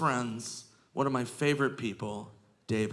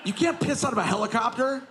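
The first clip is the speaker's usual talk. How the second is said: high, incredulous mock scolding; exasperated retort; 9 semitones higher than usual. Comedian acting his soaked friend saying he was joking, exasperated. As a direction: protest in strained disbelief. Friend yells back in acted quarrel.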